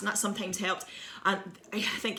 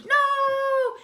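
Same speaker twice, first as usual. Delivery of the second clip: very high, loud mock wail; horrified wail; 6 dB louder than usual. Child watching the pie land, high horrified wail. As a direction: wail in melodramatic despair.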